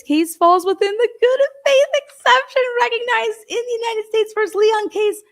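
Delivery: climbing into a very high, incredulous voice; mocking read-out. Mockingly reading out the ruling.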